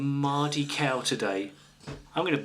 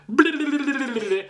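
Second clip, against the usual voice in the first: high loud babbling mockery; mock wife impression; 11 semitones higher, 5 dB louder than usual. Husband mocking his wife's nagging with shrill blahs. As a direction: squeal the blahs in a silly high voice. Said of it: "And you go".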